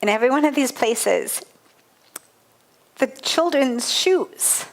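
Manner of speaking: fighting back tears as the memory returns; choking up. Real, not acted. Recalling the slum schools to the audience, choking up. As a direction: voice catching with real tears, words slowed. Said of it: "God, it makes me cry twenty-seven years later".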